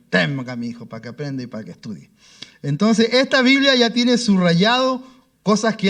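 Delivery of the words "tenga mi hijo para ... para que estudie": tender gift. Retold or imitated. Abogado entrega la Biblia.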